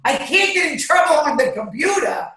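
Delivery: very high, animated outburst; high-pitched protest. Real, not acted. Speaker protesting being watched at the computer, high exclaiming. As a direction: protest in a high, incredulous squeal.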